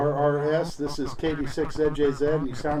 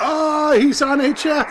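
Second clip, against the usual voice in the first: excited; 12 semitones higher, 6 dB louder, slower than usual. Real, not acted. New station heard on HF.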